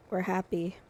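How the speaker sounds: usual speaking voice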